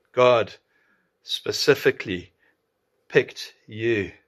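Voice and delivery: GOD, SPECIFICALLY, PICKED YOU, set apart by pauses; word-by-word emphasis. Shown as a demonstration. Preacher echoing the mother to the congregation, each word emphasized. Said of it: "she emphasized every word".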